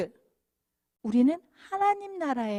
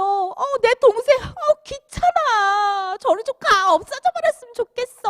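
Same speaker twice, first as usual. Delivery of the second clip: put-on high, loud fed-up kid, dragging then rushing; annoyed big brother; 9 semitones higher, 6 dB louder than usual. Preacher voicing a child tired of his sibling, irritated. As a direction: brush off a pesky sibling, childish and cross.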